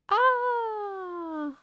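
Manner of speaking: very high, sustained cry of "ah"; long high "ah". Pretend. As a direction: draw out a high-pitched exclamation. Teacher exclaims at the picture. (long « ah » très aigu)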